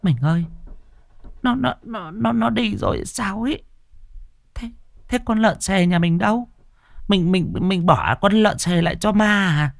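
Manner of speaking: high, stammering panicked wife; gasping for breath after running; wife stammering in fright; breathless fearful wife. A narrator's reading. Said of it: "thều thào".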